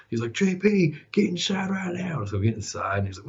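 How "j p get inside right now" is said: high-pitched, drawn-out scolding; imitated stern order. Driller orders the corporate boy inside, mock-stern and high. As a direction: summon him like an exasperated boss.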